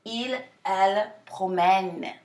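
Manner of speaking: "promène" ending on a long, smiled eh; elongated open E. Shown as a demonstration. Teacher showing where to smile, elongated eh. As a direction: slowly model "promène" with a stretched eh. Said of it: "the E is more like a eh".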